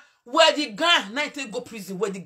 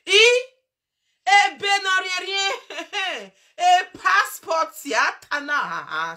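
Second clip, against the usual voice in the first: mock taunting voice; 8 semitones higher, 5 dB louder, slower than usual. Mocking the woman with a put-on voice.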